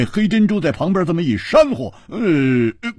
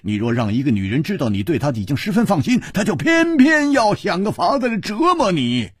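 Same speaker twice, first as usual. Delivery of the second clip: high, loud, triumphant agreement; loud exclamation; 6 dB louder than usual. A narrator's reading. Friend cheering the princess's point, loud and excited. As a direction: burst out delighted, voice pitched way up. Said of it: "大声说".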